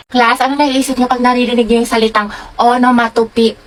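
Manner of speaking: normal talking voice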